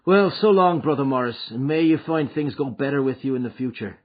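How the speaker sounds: put-on a cocky young man taking his leave; voiced McMurdo. McMurdo's parting words.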